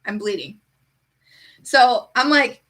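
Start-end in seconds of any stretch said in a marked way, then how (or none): 0.0-0.6 soft, flat-toned understatement of an injury; deadpan. Retold or imitated. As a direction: say it as though it's nothing. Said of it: "I'm just gonna be, like, deadpan".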